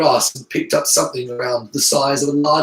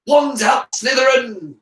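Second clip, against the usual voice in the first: higher, loud battle call; rally your side; rallying shout; 9 semitones higher, 5 dB louder, slower than usual. A narrator's reading. Slytherin answering Hufflepuff's wands, shouting.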